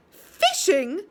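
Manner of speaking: a disgusted, rising "Fishing?". A narrator's reading. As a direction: spit the word out in disgust. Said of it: "said Joe in disgust".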